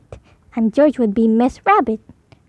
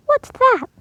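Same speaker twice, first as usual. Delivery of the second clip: put-on much higher girl's voice; startled little pig; 12 semitones higher than usual. Peppa startled by something on her plate. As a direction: pop the question up high. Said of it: "she asked".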